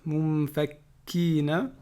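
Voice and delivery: Mumfakkina very slow, kaf held double; doubled kaf. Shown as a demonstration. Teacher drilling the shadda on the kaf, slow. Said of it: "shadda sur le kaf".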